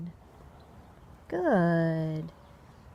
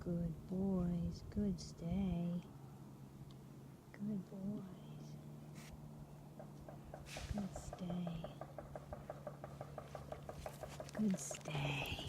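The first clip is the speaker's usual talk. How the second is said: much softer, near-whispered good stay; quiet reassuring praise for a holding stay; soft calm praise; 3 semitones lower, 19 dB quieter than usual. Real, not acted. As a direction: keep it hushed so the dogs stay settled. Calm praise while dogs hold stay.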